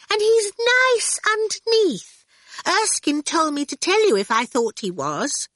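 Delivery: put-on delighted little girl. A narrator's reading. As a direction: say it with glee. Orphle confiding.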